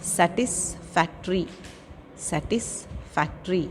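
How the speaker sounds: slow "सेटिस्फैक्टरी", broken into parts; careful pronunciation. Shown as a demonstration. Teacher giving pupils words to practise, careful pronunciation. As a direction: draw out "सेटिस्फैक्टरी" as a model to copy. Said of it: "listen to the pronunciation of the".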